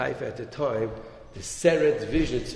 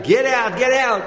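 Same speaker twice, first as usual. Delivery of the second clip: loud, high yell to get out; screaming get out; 9 semitones higher, 9 dB louder than usual. Retold or imitated. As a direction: throw him out with a shout. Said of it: "the guy starts screaming at him".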